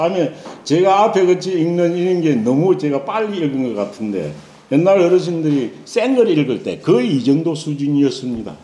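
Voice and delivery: ordinary talk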